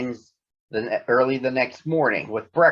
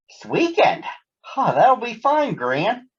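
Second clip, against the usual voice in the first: higher, friendly mother voice on the phone; Mama Bear on the phone; 10 semitones higher than usual. Acted. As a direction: chat brightly with Gran. Mama Bear talks to Gran.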